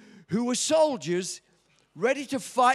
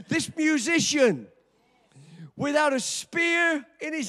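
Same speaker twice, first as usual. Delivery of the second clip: loud, high preaching climax; preaching at full force; 4 semitones higher, 4 dB louder than usual. Acted. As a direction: build it like a sermon peak.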